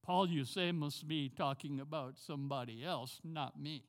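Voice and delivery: usual speaking voice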